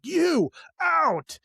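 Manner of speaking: sharp high command, imitating a bully; barked order. Father-in-law pointing at the wife, a high barked order. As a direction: throw her out in two words.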